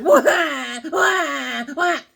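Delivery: high, wailing disbelief; mock wail. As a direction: cry out in mock despair. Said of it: "I said".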